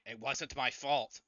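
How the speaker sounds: loud, drawn-out protest; mock scream. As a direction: yell it in panic without a full scream. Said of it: "He starts screaming".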